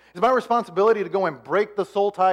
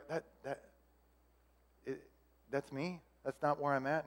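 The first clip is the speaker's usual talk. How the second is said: very soft, halting disbelief; small, hushed voice with long pauses; stunned realization; 3 semitones lower, 13 dB quieter than usual. Acted. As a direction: falter quietly, taken aback. Acted stunned reaction to being shown.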